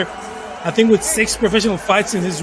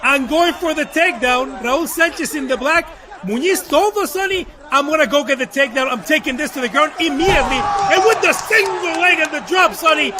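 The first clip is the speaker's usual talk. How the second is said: play-by-play; 5 semitones higher than usual. Real, not acted. Live play-by-play of a fight.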